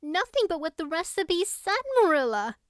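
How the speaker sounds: put-on high, anxious girl's plea; anguished cry. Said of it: "cried Anne with a look of anguish".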